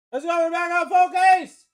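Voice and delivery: loud, high hyped shout; shouting it out over the break; hype shout. Real, not acted. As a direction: pump the chat up.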